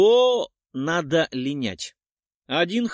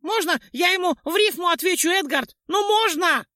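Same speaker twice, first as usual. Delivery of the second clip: high, louder begging squeak; pleading Choplya; 5 dB louder than usual. A narrator's reading. Choplya begs Edgard to answer in rhyme, high and pleading. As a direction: beg eagerly like a tiny spirit. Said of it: "взмолилась Чопля".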